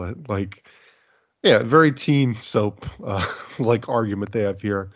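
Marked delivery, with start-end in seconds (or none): none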